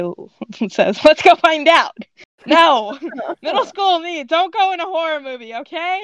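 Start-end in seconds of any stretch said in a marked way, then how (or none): 1.0-2.0 higher, loud rallying call; eager. A narrator's reading. Pager rallies friends toward the scream, eager. 2.4-6.0 high, playful exasperated protest; mock scolding. Reader tells off middle school self, mock scolding.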